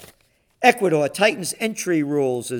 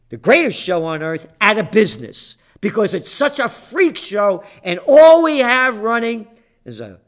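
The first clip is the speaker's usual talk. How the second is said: usual speaking voice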